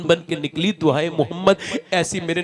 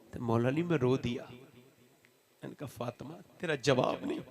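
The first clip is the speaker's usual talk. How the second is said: low, soft and tender retelling; hushed storytelling; 3 semitones lower, 9 dB quieter than usual. Acted. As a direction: draw them in quietly with the sad story. Tender tragic narration.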